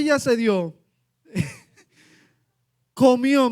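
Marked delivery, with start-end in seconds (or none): 1.2-3.5 laughing, louder burst. Real, not acted. Pastor joking about his daughter eating, laughing. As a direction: laugh with relief as you say it.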